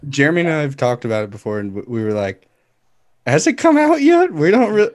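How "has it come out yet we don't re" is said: high, loud, exaggerated question; mock clueless questioning. Recalling chats with Jeremy about the movie, mock clueless. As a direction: squeal it in playful bewilderment.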